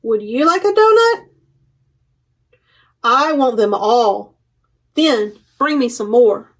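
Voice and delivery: lively picture book read-aloud with paused page turns; reading to children. Acted. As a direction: read it out to a room of little kids, giving each animal a light voice.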